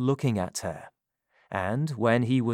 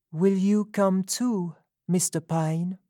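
higher, gentle woman's voice; Sophie's voice; 8 semitones higher than usual. A narrator's reading. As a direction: ask it hopefully, higher. Sophie asks softly.

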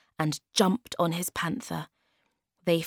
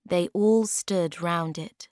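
plain reading voice

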